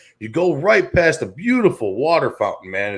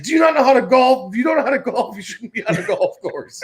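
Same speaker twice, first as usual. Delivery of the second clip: big Og's indignant rant; 8 semitones higher than usual. Retold or imitated. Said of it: "He's like".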